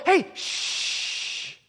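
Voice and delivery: long hushing "shh" after a high "hey"; shushing. Acted. Crowd around Jesus silences the blind beggar, shushing. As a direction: hush someone who is making noise. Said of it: "the Christians around Jesus go".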